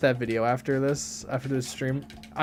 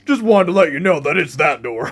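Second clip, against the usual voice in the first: mock principal; 6 dB louder than usual. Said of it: "that's what my principal sounds like".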